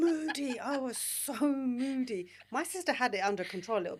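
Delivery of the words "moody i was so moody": Moody dragged out slowly and loudly, pitched up; emphatic moody. Real, not acted. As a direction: lean hard on "moody", slow and loud. Confessing teenage moodiness, emphatic.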